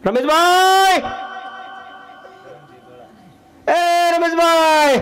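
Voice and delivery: high, loud call across the street. Acted. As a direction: shout the neighbour's name into the night, dragging it out slowly. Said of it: "એણે બૂમ પાડી".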